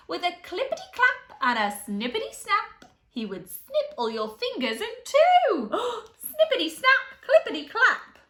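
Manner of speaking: dramatic snippy lobster build-up; snapping lobster. Acted. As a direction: snap it out with mounting glee. Lobster snipping fingers, building excitement.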